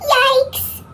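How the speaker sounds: high cartoon yelp; squeaky cartoon voice. A narrator's reading. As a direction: squeal it out in fright. Whale shadow surprise.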